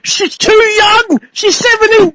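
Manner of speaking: high, slow mock fretting father; hammed-up high worried-father voice; overprotective dad. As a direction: whine it like a panicking dad. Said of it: "He's like".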